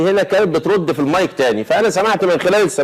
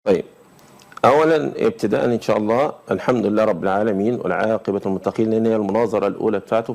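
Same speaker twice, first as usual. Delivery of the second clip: ordinary delivery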